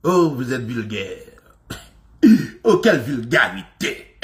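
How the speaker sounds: slow, affected shocked-snob voice; mock prim Frenchman, drawn out; prissy French snob. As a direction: play the scandalised prude clutching pearls.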